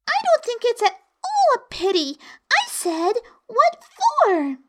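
put-on very high girlish character voice; young Alice, indignant. A narrator's reading. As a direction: correct the listener firmly in a high voice.

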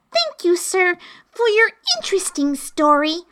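put-on high, curious girl's voice; little girl Alice. Alice questioning the Griffin in a high girl's voice. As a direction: ask it as a puzzled young girl. Alice's polite imagined reply.

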